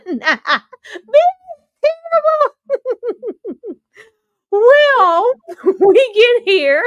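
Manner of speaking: very high, loud laughing squeal; cracking up, words squeezed out high; helpless laughter. Real, not acted. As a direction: struggle to talk through a laughing fit. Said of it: "I didn't mean to laugh".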